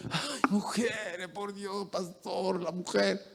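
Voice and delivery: a husband complaining to the pastor about women; whiny husband. Retold or imitated. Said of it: "el hombre dice".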